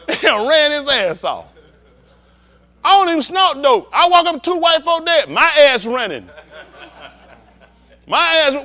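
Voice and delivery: ordinary delivery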